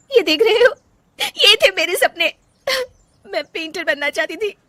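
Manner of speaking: wife showing her hidden paintings to her family; anguished. Acted. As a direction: show them your broken dreams. Shows her lost dreams.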